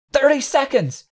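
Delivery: very high-pitched panicked warning; high, strained cry of alarm; shriek. A narrator's reading. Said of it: "Samponi's voice shrieked".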